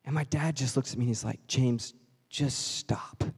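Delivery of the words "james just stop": slow, then whispered father's plea; gentle stop. Retold or imitated. Dad voiced, slow then whisper.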